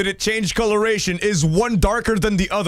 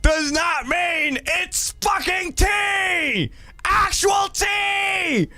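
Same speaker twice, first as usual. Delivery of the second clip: slow emphatic shout; 8 semitones higher, much slower than usual. Real, not acted. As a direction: hammer every word of the complaint. Ranting at chat about tea.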